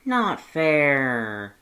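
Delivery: put-on kid's whiny protest; complaining kid. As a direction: pout it like a let-down child. Same kid complaining in crowd.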